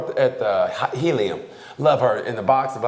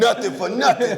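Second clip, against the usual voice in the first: New York fan; 9 semitones higher than usual. Pretend.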